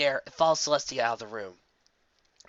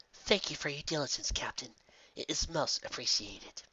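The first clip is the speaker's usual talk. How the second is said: quiet gratitude; 8 dB quieter than usual. Acted. Princess thanking guard captain.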